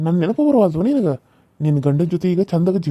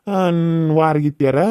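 ordinary delivery

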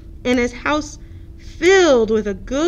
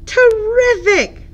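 cheerful terrific; 10 semitones higher, 5 dB louder, slower than usual. Acted. Turtle delighted.

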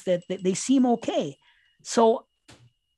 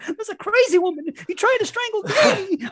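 quoting Wendy; 12 semitones higher than usual. Retold or imitated. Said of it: "she tells him".

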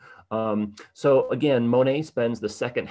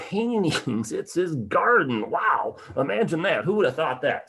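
higher-pitched, louder wow at a big reveal; amazed; 6 semitones higher, 4 dB louder than usual. Acted. Lecturer revealing Monet's masterpiece to the audience, amazed. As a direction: play up the astonishment, stretching key words.

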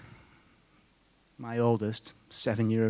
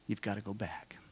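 whispered plea; 3 semitones lower, 10 dB quieter than usual. Retold or imitated. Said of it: "She was actually emotional and crying".

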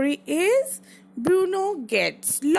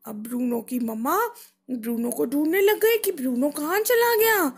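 ordinary delivery

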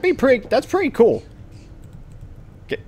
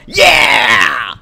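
a real cheering shout, full voice; triumphant yell; 6 semitones higher, 8 dB louder than usual. Real, not acted. Player cheering at his own pool shot, triumphant shout. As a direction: roar it out after sinking the ball.

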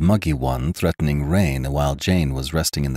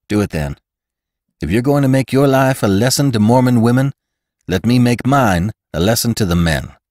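loud, high, resolute declaration; defiant vow; 7 semitones higher, 6 dB louder than usual. A narrator's reading. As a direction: throw down the challenge.

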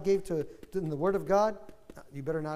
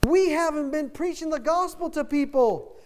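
high, loud impassioned outcry; impassioned preacher; 7 semitones higher, 4 dB louder than usual. Retold or imitated. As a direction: cry out the revelation to the church. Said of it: "He says".

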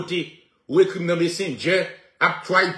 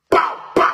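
mouth gunshot sounds. Retold or imitated. Imitating gunshots with his mouth.

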